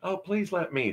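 high, eager plea; eager pleading. A narrator's reading. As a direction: beg keenly, voice jumping up. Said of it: "frightfully eager".

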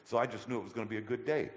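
usual speaking voice

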